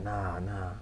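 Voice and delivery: soft retold "nah" to oneself; quiet, half-hearted refusal; hushed refusal. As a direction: say it under your breath, retelling a doubt. Quietly refusing in retold inner talk.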